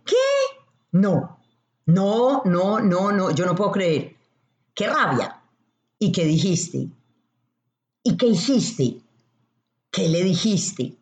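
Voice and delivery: indignant disbelief that eggs the friend on; strong, stirring tone. Shown as a demonstration. Backing an angry friend, strong indignant egging on. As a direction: push them to let the anger out. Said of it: "fuerte e incitador".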